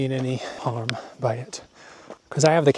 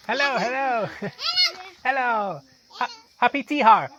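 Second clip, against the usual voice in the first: pitched-up cheerful hellos; high friendly greeting; 14 semitones higher than usual. Real, not acted. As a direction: greet passing locals sweetly and high. Greeting locals at festival.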